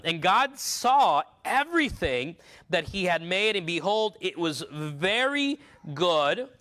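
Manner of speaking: measured, solemn Bible reading; scripture reading. Acted. Preacher reading Genesis aloud to the congregation, solemn and measured. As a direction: read the verse slowly and reverently. Reads verse thirty-one aloud.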